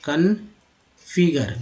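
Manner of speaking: slow, split-up configure; sounded out. Shown as a demonstration. Teacher showing learners how a word divides, slowly sounded out. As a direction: break configure into spaced syllables.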